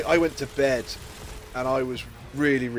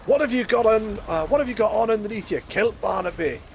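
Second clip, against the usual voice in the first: curious Scotsman; 6 semitones higher than usual. Retold or imitated. One Scotsman asking another about his kilt, pitched up. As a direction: pitch it up, nosy and prying.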